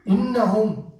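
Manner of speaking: high, heavily stressed "Innahum"; emphatic oath. Acted. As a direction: swear "Innahum" with a lifted, insistent voice. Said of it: "they say".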